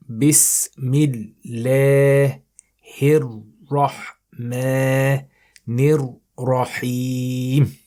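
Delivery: Quran recitation. Shown as a demonstration. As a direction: recite word by word for students to copy. Teacher recites verse slowly.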